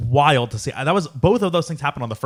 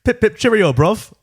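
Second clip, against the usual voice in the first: high-pitched mock British; British accent; 8 semitones higher, 3 dB louder than usual. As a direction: ham up a cheery British accent. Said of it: "Should I go with the British accent".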